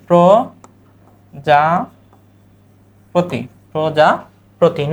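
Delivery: প্রজাপতি stretched very slowly; slow pronunciation demo. Shown as a demonstration. Teacher modelling প্রজাপতি, slow. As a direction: draw out প্রজাপতি. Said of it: "তাহলে কি?".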